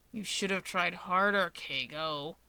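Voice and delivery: low, slow, quiet threat; Dabi, coldly angry. Acted. Dabi turning his glare on Keigo, low cold threat. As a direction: drag out his name as a warning.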